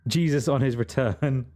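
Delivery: ordinary delivery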